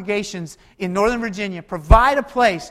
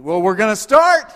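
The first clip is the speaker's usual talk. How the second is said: mock shouted retort; 8 dB louder than usual. Mock shopper overriding spouse's objection.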